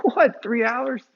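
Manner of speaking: a high, loud "what?" then a stunned echo; can't believe your ears; shocked disbelief. Retold or imitated. Shocked reaction retold.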